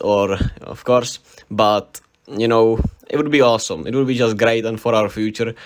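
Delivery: ordinary delivery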